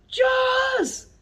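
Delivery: high, loud shout of juice; child finally shouting juice. Retold or imitated. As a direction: blurt it out like a child who finally speaks. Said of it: "finally she'll shout".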